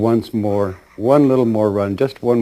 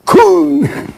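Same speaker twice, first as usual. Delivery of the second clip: high, loud launching cry; launch call; 8 dB louder than usual. Retold or imitated. Guide sending the soul off, high loud cry. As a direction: fling the word like a launch. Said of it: "he goes".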